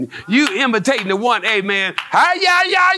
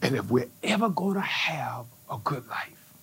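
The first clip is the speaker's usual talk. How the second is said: whispered, much lower and softer; hushed whisper; 9 semitones lower, 11 dB quieter than usual. Real, not acted. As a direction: lean in and whisper to the church. Preacher drops to a hush.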